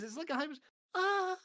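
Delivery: breathy squeaky moan; anime moan. Shown as a demonstration. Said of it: "Key's impression of an anime moan".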